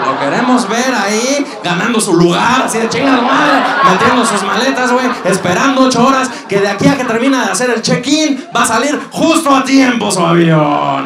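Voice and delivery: worked-up mock cheering; gleeful rant. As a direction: build a relentless high-pitched rant. Comic rant picturing the airport ordeal.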